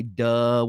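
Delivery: "duh" with a hard D replacing th; D sound opening "duh", uh vowel; Southern "duh" for "the". Shown as a demonstration. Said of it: "We'll put "D" in, in the space of "th".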